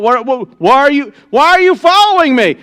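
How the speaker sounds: high, loud, desperate yell. Acted. Cry out to the men following you, high and loud. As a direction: Dan yells after the people of Dan chasing him. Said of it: "Dan yells out".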